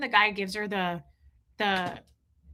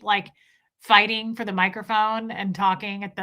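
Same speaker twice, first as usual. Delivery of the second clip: normal talking voice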